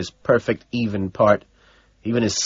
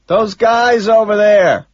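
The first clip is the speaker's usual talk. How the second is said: loud, drawn-out high yell; shouted reply, pitched high; shouting; 11 semitones higher, 8 dB louder, slower than usual. A narrator's reading. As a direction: holler it slowly over the noise. Shouted exchange at a concert.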